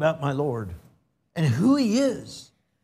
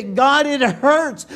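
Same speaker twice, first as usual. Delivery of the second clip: praying in pain; 7 semitones higher, slower than usual. Acted. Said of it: "He can say".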